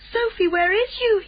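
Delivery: anxious, pleading inner call; desperate. A narrator's reading. Giant calls out for hidden Sophie, desperate. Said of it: "he thought desperately".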